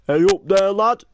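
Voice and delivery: cheery Northern greeting. Shown as a demonstration. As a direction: call it out brightly up the stairs. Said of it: "and then I go".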